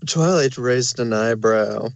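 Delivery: ordinary delivery, nothing marked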